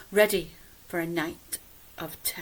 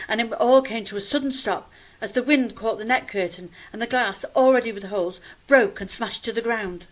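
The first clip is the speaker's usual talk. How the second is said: loud, heightened storytelling; dramatic climax; 4 semitones higher, 10 dB louder than usual. A narrator's reading. Narrator telling of the window smashing, loud and dramatic. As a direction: raise pitch and volume for the sudden shock.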